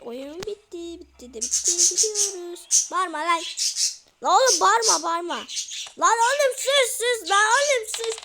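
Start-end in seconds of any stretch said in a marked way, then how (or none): 1.8-2.9 loud, slow drawn-out cheer; triumphant yell. Real, not acted. Cheering as they escape the house, loud triumph. 2.9-8.2 very loud, high yells of sus; frantic shout. Real, not acted. Yelling at the screaming man to shut up, frantic.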